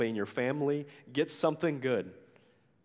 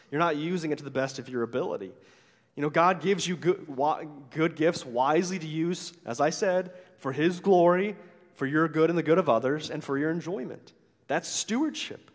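ordinary delivery

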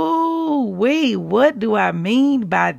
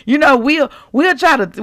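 normal talking voice

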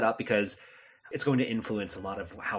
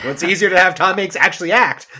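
loud, higher, half-joking exasperation; mock exasperated producer; 7 semitones higher, 14 dB louder than usual. Producers giving up on teaching the kid, loud mock exasperation. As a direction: blurt it out like a fed-up producer. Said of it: "they're just like".

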